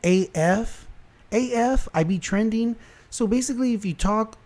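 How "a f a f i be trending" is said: mocking the article's phrase, pitched higher; mild sarcastic imitation, higher; mocking quote. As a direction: parrot it back in a higher mock voice. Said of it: "they're saying".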